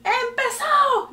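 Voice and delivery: high, stretched-out start call; imitating a drawn-out announcer call; store loudspeaker announcer. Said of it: "se escucha por adelante y dice".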